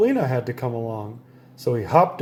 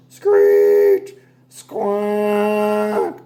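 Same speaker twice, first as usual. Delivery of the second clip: sax noise; 6 semitones higher, 5 dB louder than usual. A narrator's reading. Saxophone noises.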